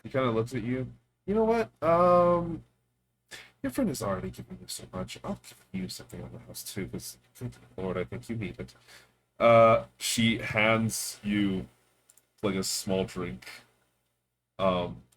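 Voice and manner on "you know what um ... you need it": lively higher character voice; barkeep voice. Acted. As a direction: play the generous barkeep. GM voicing Enka.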